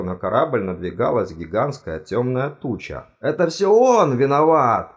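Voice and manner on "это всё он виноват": loud, higher blaming shout; accusing yell. A narrator's reading. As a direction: shout the blame at him. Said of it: "заорал декан". (обвиняющий крик)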